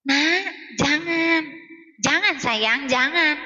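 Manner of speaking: very slow, limp mock-gentle mother; a half-hearted "jangan, sayang" that fails to stop the child; weak retold mum. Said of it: "ibunya cuma gini doang".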